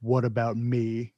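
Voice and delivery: low, slow line delivery; Stallone. Retold or imitated. Friend mimics the movie's out-of-nowhere line, in a low slow Stallone voice. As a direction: drop it low and draw it out, slowly. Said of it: "and then Stallone goes".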